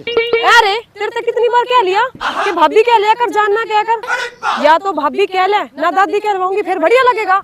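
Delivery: high-pitched indignant telling-off; exasperated high-voiced reproach; scolding correction. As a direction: correct them sharply, pitched well up. Scolding over being called jaan.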